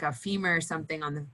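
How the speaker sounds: usual speaking voice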